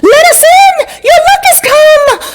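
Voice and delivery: very high, loud shout; fairies shouting. A narrator's reading. Angry fairies shout at the locked door, very high and loud. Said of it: "banged harder".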